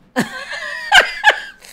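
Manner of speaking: high, loud shriek of laughter; real shrieking laugh. Real, not acted. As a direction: burst out laughing at the memory. Laughs about using the look on her son.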